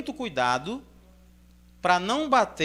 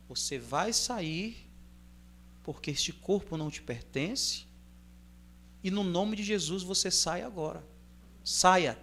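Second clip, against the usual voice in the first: calm command; 7 dB quieter than usual. Shown as a demonstration. Preacher shows the church how to cast out a demon calmly, quiet and firm. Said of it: "se quiser ter uma entonação maior, tudo bem".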